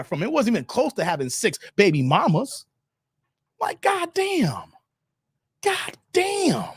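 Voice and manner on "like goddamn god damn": slow, high exasperated swear; higher slow goddamn; drawn-out goddamn. Real, not acted. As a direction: stretch out the disbelief. Exasperated exclamation.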